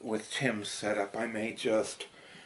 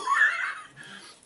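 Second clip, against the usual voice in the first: burst of laughter; 10 dB louder than usual. Real, not acted. Laughing at own flub.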